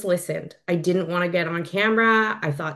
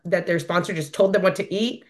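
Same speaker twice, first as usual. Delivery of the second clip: ordinary talk, nothing marked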